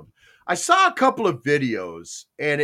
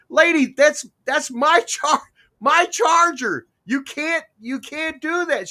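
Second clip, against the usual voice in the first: raised, higher-pitched exasperated objection; mock exasperation, pitched up; retold indignant protest; 8 semitones higher, 4 dB louder than usual. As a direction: act out an outraged owner confronting a freeloader. Acting out owner confronting charger user.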